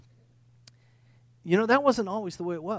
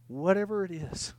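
normal talking voice

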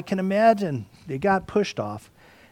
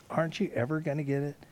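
faster, pressing exasperated repeat; fast, frustrated second ask; quicker repeat; 8 dB quieter, faster than usual. Retold or imitated. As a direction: push the question again, quicker. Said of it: "And he sighs deeply".

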